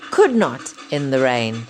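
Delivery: plain reading voice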